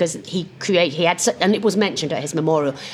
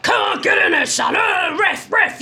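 shouting dad; 13 semitones higher than usual. Retold or imitated. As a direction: bellow at the pitch and the referee. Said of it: "my husband would be like".

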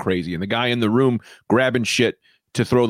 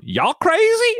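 much higher, lightly put-on Southern twang; mock Southern accent; much slower than usual. Joke about foreign accent syndrome, light mock Southern voice. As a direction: toss it out like a sudden drawl. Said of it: "you wake up and you're just going".

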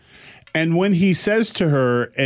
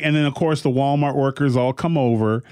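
usual speaking voice

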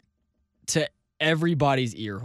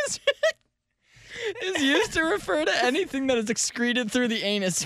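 laughing; 10 semitones higher than usual. Real, not acted. Reading while giggling.

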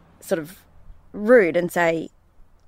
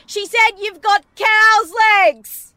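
put-on very high, slow, laughing child voice; giggling son repeating the insult; little boy voice; 13 semitones higher, 7 dB louder, much slower than usual. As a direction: innocently blurt it like a small kid. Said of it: "And Digby said".

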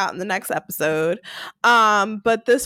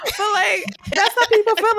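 laughing; 10 semitones higher than usual. Real, not acted. Laughing through the point.

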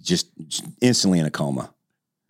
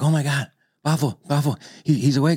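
higher, amazed relief; high excited gasp; 10 semitones higher than usual. Retold or imitated. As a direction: exclaim in disbelief. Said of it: "they're like".

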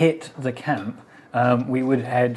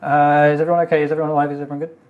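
rushed, louder, tumbling words; panicked rush; 4 semitones higher, 7 dB louder, faster than usual. Acted. Quill wakes from the nightmare and panics at the party, rushed and loud. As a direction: blurt it all out in a panic. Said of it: "You're speaking very quickly".

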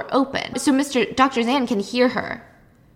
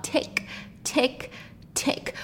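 clock ticking; 4 semitones higher, 9 dB quieter, much slower than usual. Retold or imitated. Imitating grandfather clock ticking.

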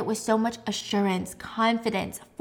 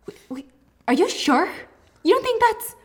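startled protest, rising sharply; alarmed; 9 semitones higher, 4 dB louder than usual. Retold or imitated. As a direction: voice jumps way up and louder in alarm. Trisha alarmed at shower plan.

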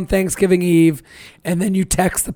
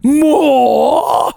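higher, wailing mock-British orphan; Oliver Twist; 6 semitones higher, 4 dB louder than usual. Comic playing an orphan begging for food, hammed-up British plea. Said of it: "you'd need Oliver Twist".

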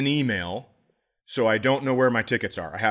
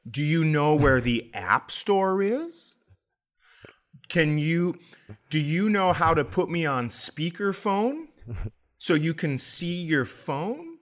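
higher, slow, patient helper voice; talking to an old man; 5 semitones higher than usual. Retold or imitated. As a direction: speak slowly as to a confused elder. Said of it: "now she's talking like this".

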